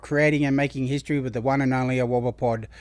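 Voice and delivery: loud, upbeat announcer welcome; podcast host intro. Acted. Host welcomes viewers to the podcast, in a loud, upbeat announcer voice. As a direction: sell the show like a hype host.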